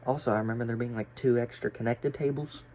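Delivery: normal talking voice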